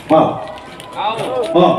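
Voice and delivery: usual speaking voice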